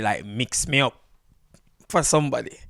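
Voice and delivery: ordinary talk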